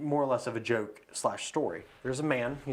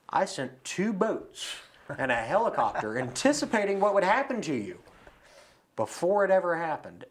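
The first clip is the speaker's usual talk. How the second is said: higher, louder mock exasperation; exasperated God; 6 semitones higher, 4 dB louder than usual. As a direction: spell it out in disbelief, breaking into a laugh. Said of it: "And God said".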